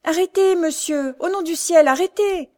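high, loud terrified plea; panicked pleading cry. A narrator's reading. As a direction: beg the coachman to stop, frantic. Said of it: "elle s'écriait". (jeune aveugle affolée suppliant le cocher, cri aigu et fort)

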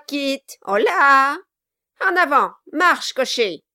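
loud, high mock sympathy; mocking dwarf. Quilp sends off the carriage, mock pity. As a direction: jeer with false pity.

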